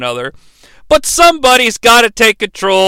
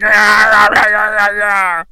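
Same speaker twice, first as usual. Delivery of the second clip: imitated wrestler yell; 2 semitones higher than usual. Mimicking X-Pac jumping with a headlock on, droning yell. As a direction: hold a long, level mock holler. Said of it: "yells like".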